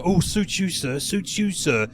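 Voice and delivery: sketch tailor's oily catchphrase; Paul Whitehouse. Acted. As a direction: imitate a tailor fawning over a customer. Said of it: "the tailor who would go".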